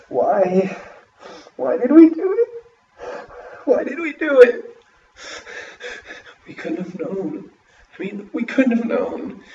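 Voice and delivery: high, loud, crying wail; sobbing lament. A narrator's reading. Grieving parents sobbing over their lost children, high and loud. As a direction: cry out in guilt and grief. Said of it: "It was sobbing".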